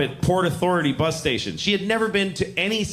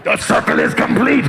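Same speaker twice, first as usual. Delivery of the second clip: loud, raspy, high sorcerer cry; ritual proclamation; 8 semitones higher, 7 dB louder than usual. Pretend. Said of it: "this is the noise I make when I come".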